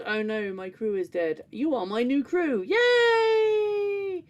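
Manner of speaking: hammed-up computer, rising to a high cheering yay; mock computer voice. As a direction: play the computer fake-sad, then squeal yay. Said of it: "the computer goes".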